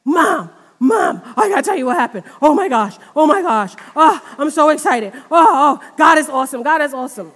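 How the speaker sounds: gushing child's excitement, retold; retelling her son's excited late-night call, excited. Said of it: "he called me up really excited".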